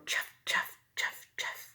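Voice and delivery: whispered chuff; soft shoe sound. A narrator's reading. Teacher imitates father's shoes on the path, whispered. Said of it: "that soft sound again".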